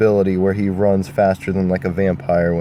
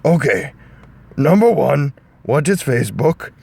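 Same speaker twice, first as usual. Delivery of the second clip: Shrek voice; 3 semitones higher than usual. Acted. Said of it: "Shrek was like".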